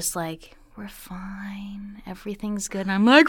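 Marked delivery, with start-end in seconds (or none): 0.8-2.8 soft reassuring whisper. Retold or imitated. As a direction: hush her calmly. Husband's calm whisper retold.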